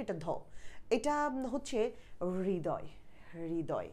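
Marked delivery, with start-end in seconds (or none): 2.5-3.9 হৃদয় softly, with a breathed h before ri; hri-doy for হৃদয়; demonstrated pronunciation. Shown as a demonstration. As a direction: let the h of হৃদয় out first, then ridoy. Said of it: "আমরা এরকম বলি".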